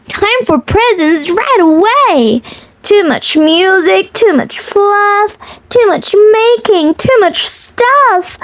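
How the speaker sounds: lilting picture-book read-aloud; reading to children. Acted.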